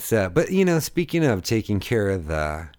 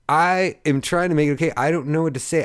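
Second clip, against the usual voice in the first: flustered; 7 semitones higher, 3 dB louder than usual. Retold or imitated. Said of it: "I'm like".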